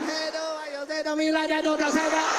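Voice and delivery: put-on Vietnamese accent swooping past like a speeding car; drive-by mock-Vietnamese blur; passing-car voice. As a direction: rush the line by as if from a passing car. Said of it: "they drive by you real fast when they're talking".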